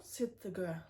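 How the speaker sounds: plain reading voice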